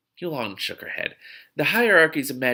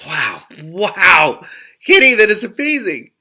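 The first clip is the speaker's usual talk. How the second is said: loud, high burst of real delight; excited outburst; 8 semitones higher, 12 dB louder, slower than usual. Real, not acted. Reader breaking off the book to tell Kitty, thrilled and loud.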